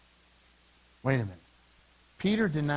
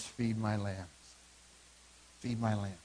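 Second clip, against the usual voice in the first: low, soft voice; gentle charge; 8 semitones lower, 8 dB quieter than usual. Acted. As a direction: give a tender command, hushed and low. Jesus gently charges Peter.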